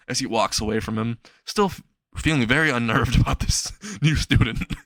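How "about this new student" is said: ordinary delivery, nothing marked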